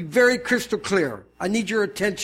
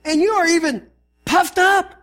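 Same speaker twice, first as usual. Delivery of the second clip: high, loud indignant reading; Paul, ticked off; 8 semitones higher, 5 dB louder than usual. Acted. Pastor voices Paul rebuking a proud church, indignant. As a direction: throw it at them in indignation. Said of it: "I mean, he's ticked".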